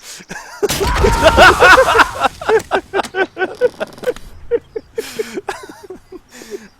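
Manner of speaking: long, high, loud cackling; maniacal laughter. Acted. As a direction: cackle like a gleeful villain. Explodes bomb.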